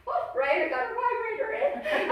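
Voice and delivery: high, loud, laughing mock quote; mocking roommates. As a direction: mimic them, high and laughing.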